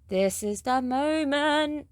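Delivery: high, drawn-out dramatic build-up; dramatic reveal. Real, not acted. Reviewer announces the pudding moment to viewers, dramatic reveal. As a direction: stretch it out slowly for a big reveal.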